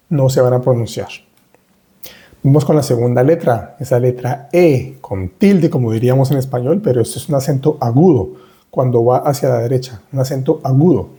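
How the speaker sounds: usual speaking voice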